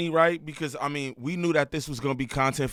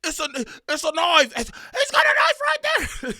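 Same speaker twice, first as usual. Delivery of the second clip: high, loud panicked yell; hammed-up screaming, much higher and louder; mock scream; 14 semitones higher, 6 dB louder than usual. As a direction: scream it like you just spotted a knife. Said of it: "How he was screaming".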